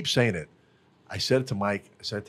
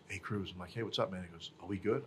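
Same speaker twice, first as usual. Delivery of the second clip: put-on softer, lower, sheepish kid voice; quiet worried kid; 4 semitones lower, 11 dB quieter than usual. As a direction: hang your head and ask quietly.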